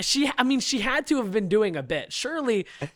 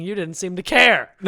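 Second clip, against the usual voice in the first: sarcastic retort; 10 dB louder than usual. Retold or imitated. Retold retort to Karen.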